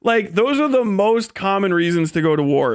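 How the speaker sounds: ordinary talk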